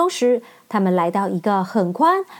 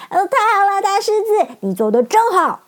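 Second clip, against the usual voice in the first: much higher, louder joyful cheer; happy cheering; 10 semitones higher, 3 dB louder than usual. Acted. Dorothy cheers the brave lion, much higher and louder. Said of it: "高兴地大叫起来".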